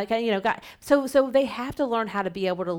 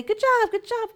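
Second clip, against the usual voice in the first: exaggerated praise; 5 dB louder than usual. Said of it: "you don't wanna say".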